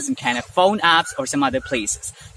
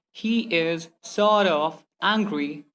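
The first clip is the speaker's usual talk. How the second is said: very slow, words set apart; much slower than usual. Shown as a demonstration. Teacher repeating the slow example, word-by-word. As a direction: separate "sort" from "of". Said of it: "Instead of saying".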